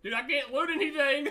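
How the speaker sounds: loud laughing complaint; exasperated yell. Real, not acted. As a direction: yell it in disbelief.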